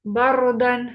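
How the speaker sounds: बरदन with a loud, full heavy र. Shown as a demonstration. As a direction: stress the thick र in बरदन. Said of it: "रॉ पुर पड़ जाएगी". (बरदन में र पुर, ज़ोर से)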